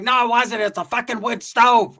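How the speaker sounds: high, emphatic, drawn-out retort; indignant mom. Retold or imitated. Mom correcting the story of the stove, indignant. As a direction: voice a mom insisting loudly, pitch up.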